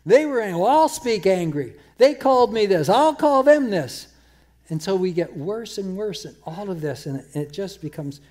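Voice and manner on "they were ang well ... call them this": imitating a petty angry comeback, louder; mock angry retort growing louder; mock tit-for-tat retort. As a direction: play the person firing back, building louder and higher. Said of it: "by you coming back".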